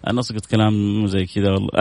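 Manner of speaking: usual speaking voice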